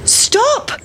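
mild storybook shout, pitched right up; mother's shout. A narrator's reading. Mother calls after runaway pancake, high shout. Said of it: "shouted the mother".

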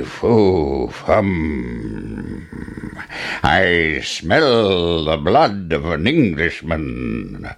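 slow giant's chant, drawn-out words; menacing giant. Acted. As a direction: stretch every word like a hungry giant. Giant sniffs out Jack.

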